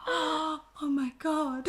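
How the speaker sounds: slow, hushed, awed oh my God; gasping at his sweetness, hushed; breathless gasp. Retold or imitated. Retelling her reaction to him, slow hushed awe.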